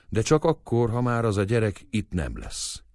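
ordinary delivery, nothing marked

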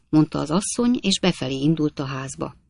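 usual speaking voice

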